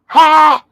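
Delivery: sharp high triumphant Ha; loud scornful laugh; mocking shout laugh. Real, not acted. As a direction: bark out a gloating laugh. Mocking triumph over rival.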